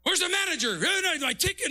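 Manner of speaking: high-pitched mock complaint in English; a grumbling man imitated in English; annoyed customer. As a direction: imitate a cranky customer demanding the manager.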